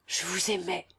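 whispered admission; blurted confession. A narrator's reading. Sick man finally confesses his love, whispered confession. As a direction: let it escape in a whisper. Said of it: "laissa-t-il échapper à la fin".

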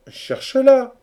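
very high, loud order from friends; urging. A narrator's reading. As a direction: urge it at full voice. Chorus fires advice at hero. (injonction criée, très aiguë)

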